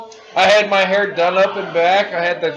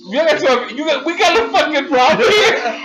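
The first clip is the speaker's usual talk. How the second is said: laughing mock threat; 8 semitones higher, 3 dB louder than usual. Retold tough-guy threat, laughing.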